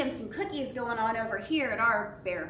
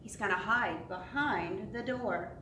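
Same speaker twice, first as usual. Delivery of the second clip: usual speaking voice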